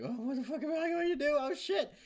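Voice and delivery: high-pitched mock panic squeal; freaking out. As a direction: imitate someone losing it completely. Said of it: "freaking out".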